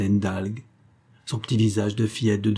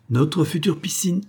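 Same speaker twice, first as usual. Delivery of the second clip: higher-pitched, proud announcement; triumphant; 7 semitones higher than usual. A narrator's reading. Host shows the doctor his future pool, triumphant. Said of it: "triompha monsieur H".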